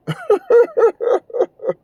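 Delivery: a high, scornful burst of laughter; incredulous high laugh; high mocking laugh. Real, not acted. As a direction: laugh it off in disbelief. Scoffing at accent claims.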